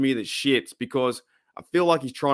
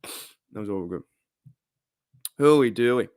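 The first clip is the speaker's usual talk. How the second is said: ordinary delivery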